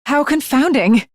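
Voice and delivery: ordinary talk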